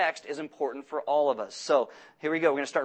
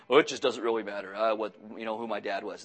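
careless prince voice; 8 semitones lower than usual. Retold or imitated. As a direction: shrug it off like a spoiled prince. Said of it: "the prince says".

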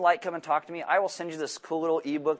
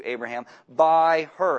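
"by her" slow and louder, hit hard; stress on by her; 8 dB louder, slower than usual. Shown as a demonstration. Pastor driving the point home to the congregation, "her" stressed. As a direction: lean on "her" with full weight. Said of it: "In case you missed it the first hundred times".

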